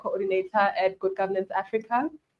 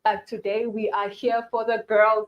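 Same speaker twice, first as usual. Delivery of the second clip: ordinary delivery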